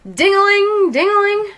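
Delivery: high, loud ringing sing-song; phone ringing. A narrator's reading. The fire station phone rings, high loud ding-a-ling. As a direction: ring like a bell.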